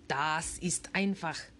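slow German, matter-of-fact; calm German ghost, it's easy; German accent. A narrator's reading. Gutenberg tells the kids it's easy in German accent. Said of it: "a thick German accent".

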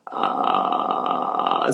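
creaky unvoiced croak; vocal fry. Shown as a demonstration. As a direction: crackle like a creaking barn door. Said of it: "Vocal Fry nennt sich das".

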